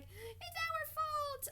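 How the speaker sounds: high, slow mock sob; hammed-up tearful girl; crying girl. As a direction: wail it like a guilty child crying. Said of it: "they cried and they were like".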